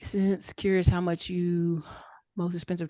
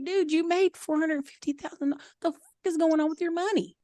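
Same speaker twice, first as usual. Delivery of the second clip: incredulous accountant; 9 semitones higher, 4 dB quieter than usual. Retold or imitated. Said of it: "And he's like".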